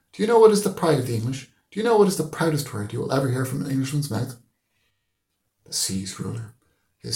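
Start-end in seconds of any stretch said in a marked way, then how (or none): 0.1-4.4 higher, louder, faster rhetorical quiz; rising fervour. Acted. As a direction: build up to the big question. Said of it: "Mister Deasy said".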